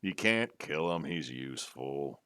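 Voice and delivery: low, quiet dwarf voice; another dwarf. Acted. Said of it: "Just another dwarf".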